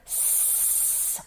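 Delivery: S as a long voiceless hiss; s sound. Shown as a demonstration. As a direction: hiss S like a snake. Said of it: "We hear it up here".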